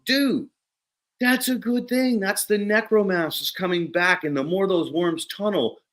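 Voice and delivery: high, emphatic good-news delivery; retold enthusiastic explaining; excited reassurance. Retold delighted answer to worried farmer.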